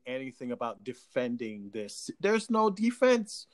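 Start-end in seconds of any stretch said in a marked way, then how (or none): 2.2-3.5 raised, slow, insistent objection; emphatic protest. Real, not acted. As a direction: insist firmly, drawing the words out. Insisting nobody may defend the remark.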